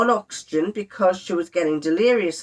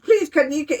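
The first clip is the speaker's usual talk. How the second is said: high-pitched mock tearful plea; imitating crying; 8 semitones higher than usual. Wife imitates husband begging for a hug, crying. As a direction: beg tearfully, putting on his voice. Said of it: "he started crying".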